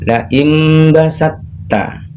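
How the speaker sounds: imbasatta drawn out slowly, the t merged into the doubled tt; slow recitation demo. Shown as a demonstration. Teacher to parents and children, slow recitation demo. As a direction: recite imbasatta slowly, s flowing straight into a held tt. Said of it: "huruf tak sukunnya dileburkan".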